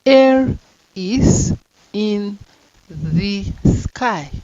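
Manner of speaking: very slow, air said loud; slow and deliberate; slow dictation. Shown as a demonstration. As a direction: drag each word out. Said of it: "so that it go easy".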